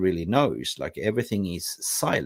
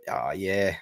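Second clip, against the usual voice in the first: ordinary talk, nothing marked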